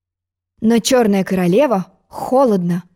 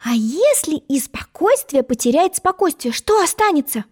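put-on young girl thinking; 7 semitones higher than usual. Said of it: "подумала Алиса".

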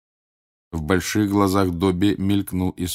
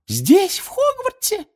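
very high, amazed elf question; tiny house-elf voice rising sharply; astonished Winky; 4 dB louder, slower than usual. A narrator's reading. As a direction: squeak it in disbelief. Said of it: "она вытаращилась на Гермиону".

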